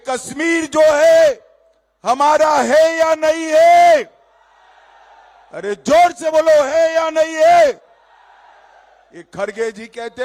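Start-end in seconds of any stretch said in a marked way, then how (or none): 0.1-7.9 high, loud rally question; shouting. Real, not acted. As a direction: demand an answer loudly. Rally crowd call and response.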